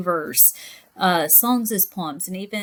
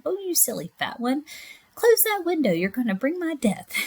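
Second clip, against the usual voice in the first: high, scolding voice; a hint of a cranky old woman scolding; 7 semitones higher than usual. Shown as a demonstration. Poem character scolds.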